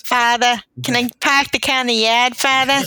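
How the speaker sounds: put-on broad joke Boston accent, dropped r's; mock Boston accent. Riffing on the actress's slipping accent, mock Boston accent. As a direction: ham up a Boston accent on every "ar". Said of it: "you hear a Boston accent".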